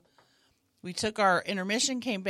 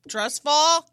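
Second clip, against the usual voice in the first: raised, louder mock scream; retold scream; 8 semitones higher, 7 dB louder than usual. Rehab storyteller demonstrating the move, mock scream. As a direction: call it out high like a falling warning. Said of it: "and scream".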